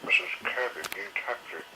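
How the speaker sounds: ordinary delivery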